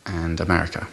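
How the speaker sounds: normal talking voice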